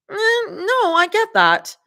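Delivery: put-on high, bright young woman voice; Zoe voice.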